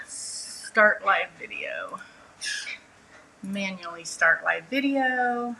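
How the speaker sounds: reading prompts under her breath; slow, quiet, half to herself; quiet muttering. Real, not acted. Reading stream setup prompts aloud.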